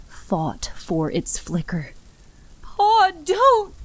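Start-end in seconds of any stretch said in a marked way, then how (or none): 2.6-3.7 high, loud cry to stop him; a warning scream; frantic plea. Acted. Daughter stops father stepping out.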